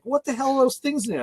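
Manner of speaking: high, incredulous question; imitated bafflement. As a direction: mimic a puzzled coworker. Coworker puzzled by pistachios, retold.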